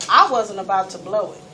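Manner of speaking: usual speaking voice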